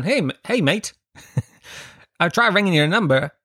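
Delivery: high, quick mock Australian chugger; chugger pestering. Australian chugger catching the comic outside his flat, high cheery voice. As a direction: chase him down brightly, high and cheery. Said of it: "He would have gone".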